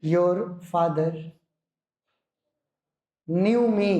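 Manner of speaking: your father drawn out slowly for writing; teacher dictating slowly to the class; slow dictation. Shown as a demonstration. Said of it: "एक और लिखो".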